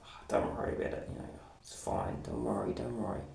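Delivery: soft, breathy-quiet "don't worry", fast at first; imitating the canteen boss softly brushing it off; hushed reassurance. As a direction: hush it like it's nothing to fuss about. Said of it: "the one that was all like".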